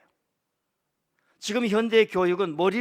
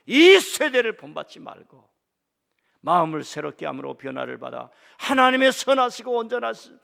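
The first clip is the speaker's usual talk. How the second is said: emphatic preaching; 8 dB louder than usual. Acted. Verse repeated with emphasis.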